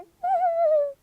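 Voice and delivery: high mock whimpering sob; play-acted boo-hoo; baby bear crying. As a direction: pretend baby wail, thin and high. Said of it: "he began to cry".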